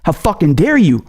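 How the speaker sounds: usual speaking voice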